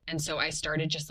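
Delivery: usual speaking voice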